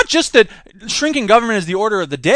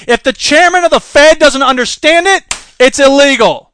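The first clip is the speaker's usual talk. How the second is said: louder, punching out the rule; emphatic rant; 4 semitones higher, 7 dB louder than usual. Real, not acted. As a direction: declare it like a new law, loud. Ranting rule about the Fed.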